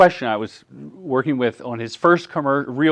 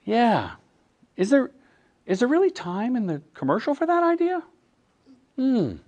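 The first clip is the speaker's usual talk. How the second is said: high, coaxing doubtful questioning; sly leading question pitched up; Jedi mind trick; 9 semitones higher, 5 dB quieter than usual. Retold or imitated. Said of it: "Jedi tricks that I use, I'll go".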